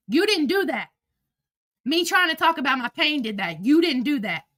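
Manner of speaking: ordinary talk, nothing marked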